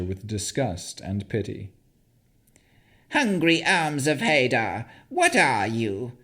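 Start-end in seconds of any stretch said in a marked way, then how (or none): 3.1-6.2 high hag voice with a touch of anger; angry hag. Acted. The hag crawling toward Navara, mildly angry crone voice. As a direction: snarl lightly like an irritated crone. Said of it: "the hag asked angrily".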